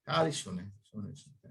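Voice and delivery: ordinary delivery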